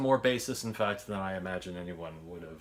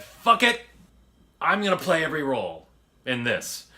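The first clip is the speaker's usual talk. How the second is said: loud, high burst on "fuck it"; excited outburst; 5 semitones higher, 6 dB louder than usual. Retold or imitated. Filmmaker deciding to play every role, loud and excited. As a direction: blurt it out, thrilled. Retelling his excited decision.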